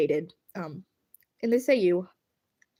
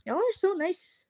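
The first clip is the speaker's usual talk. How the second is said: squeaky thank-you; 8 semitones higher, 4 dB quieter than usual. Real, not acted. Thanking chat on stream.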